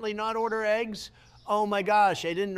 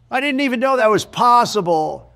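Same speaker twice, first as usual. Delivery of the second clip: loud disbelief; 3 semitones higher, 9 dB louder than usual. Real, not acted. Disbelief after trashing product.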